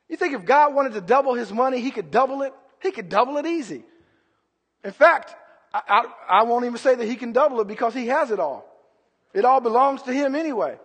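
normal talking voice

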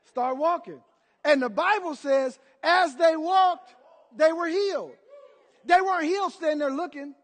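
loud, high preacher's proclamation; ringing, emphatic sermon delivery; full-force preaching. Real, not acted. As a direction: drive it out to the whole congregation.

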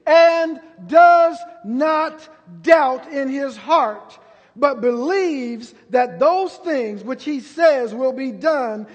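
high, loud, slow thundering proclamation; preaching at full force. Acted. Preacher proclaiming Jesus' answer to Peter, thundering slowly. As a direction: boom out the words of Jesus.

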